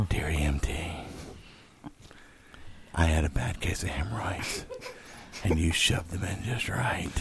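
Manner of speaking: low breathy whisper. Acted. Mock sexy Craigslist ad.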